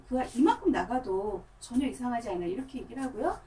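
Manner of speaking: ordinary delivery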